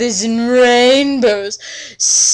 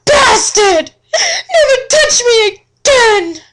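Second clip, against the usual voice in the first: hammed-up shrieking fury, much higher and louder, half crying; furious outburst; 7 dB louder than usual. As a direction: screech it like a melodramatic heroine. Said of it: "I gasped".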